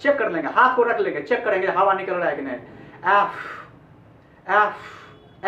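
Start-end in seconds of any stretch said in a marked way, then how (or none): 2.6-3.3 एफ with air flowing out, lips not closed; breathy English f sound. Shown as a demonstration. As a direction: let air escape on the f of एफ. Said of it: "ऐसे बोलना चाहिए".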